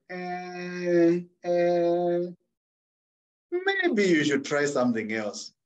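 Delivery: long, drawn-out reluctant hedging; retold hesitant doubt. Listeners doubting a would-be singer, drawn-out hesitant hedging. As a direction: stall on each "eh", then slowly let them down. Others reacting to a poor singer.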